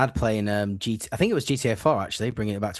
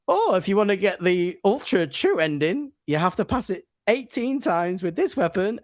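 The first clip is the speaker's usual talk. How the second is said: higher mocking announcer voice; mocking game voice; 7 semitones higher than usual. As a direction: parody the game's smug demand. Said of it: "and then".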